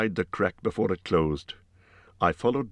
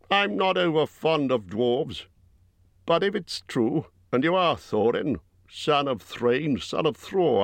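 gruff Bjorn; 7 semitones higher than usual. A narrator's reading. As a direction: voice Bjorn as a blunt giant host. Bjorn voiced by narrator.

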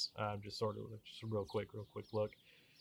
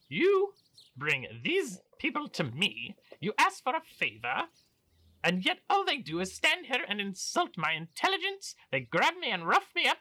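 offended outburst; 14 semitones higher, 8 dB louder than usual. Acted. Baron's offended exit.

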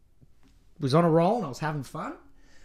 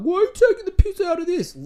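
high, loud mock-offended whine; mocking whiny listener; 13 semitones higher, 8 dB louder than usual. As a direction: squeal it like an outraged prude.

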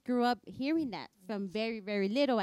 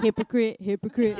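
louder, sing-song teasing; teasing call; 12 dB louder than usual. Acted. Friend teasing a mom who cusses, louder and mocking. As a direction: taunt your friend playfully.

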